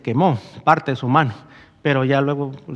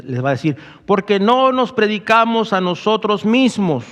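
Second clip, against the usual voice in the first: louder, higher preaching at full force; fervent pulpit preaching, raised and louder; 3 semitones higher, 4 dB louder than usual. Real, not acted. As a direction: proclaim it to the congregation, louder.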